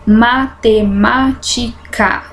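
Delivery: slow, every syllable separated; measured syllable-counting delivery of "matemática". Shown as a demonstration. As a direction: break the word into its syllables. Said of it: "tem cinco sílabas".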